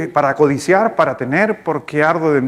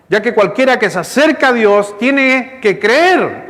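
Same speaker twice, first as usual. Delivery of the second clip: rising to a higher, slower, insistent preach; fervent preaching; 5 semitones higher, slower than usual. Acted. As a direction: hammer it home to the congregation. Pastor drives home the point.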